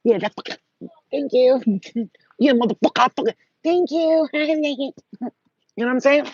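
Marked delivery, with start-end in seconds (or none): none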